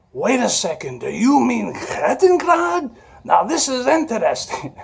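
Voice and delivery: high, drawn-out imitated German accent. Veteran suddenly recognising the Kettenkrad, imitated high German accent. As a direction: put on an old man's suddenly German accent. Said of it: "his accent changed to this, this German, uh, accent".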